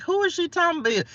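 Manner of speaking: high, puzzled stranger's voice; confused onlookers. Retold or imitated. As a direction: play a baffled passerby peering into the car. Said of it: "folks probably be looking in the car like".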